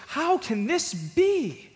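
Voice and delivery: high, slow, puzzled wondering; perplexed Moses. Retold or imitated. Preacher voicing Moses before the bush, perplexed and slow. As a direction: ask it like a baffled shepherd, drawn out. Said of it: "A little perplexed".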